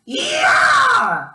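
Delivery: high, loud yell as she pulls; nurse's yank shout. Retold or imitated. Nurse yanking the stent out, yelling high and loud. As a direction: yell it out on the pull. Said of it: "she was like".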